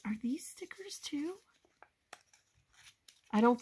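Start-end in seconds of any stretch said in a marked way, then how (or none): 0.0-1.4 soft whispered question; hushed, curious whisper. Real, not acted. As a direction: whisper it to yourself while checking. Quietly checking more pieces.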